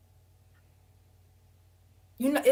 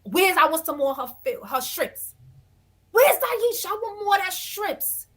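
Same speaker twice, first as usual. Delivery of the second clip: loud, high mock yell for more shrimp; hollering from downstairs for more shrimp; retold screaming; 6 semitones higher, 3 dB louder than usual. Woman hollering downstairs for Aisha's shrimp, mock yell. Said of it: "this woman is downstairs screaming".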